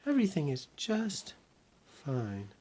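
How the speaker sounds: put-on little girl voice.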